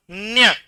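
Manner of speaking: न्य hummed through the nose; nasal sound. Shown as a demonstration. Teacher models the last letter of the row, nasal न्य. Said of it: "नाक में बोलो".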